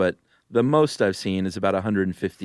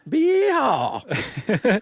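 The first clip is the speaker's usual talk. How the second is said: mock cowboy whoop; 4 dB louder than usual. Cowboy whoop after shooting gun.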